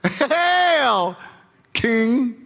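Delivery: very high, drawn-out jeer; mock-salute him like a rowdy soldier; strained, high, derisive hailing; mocking shout. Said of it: "mocking him".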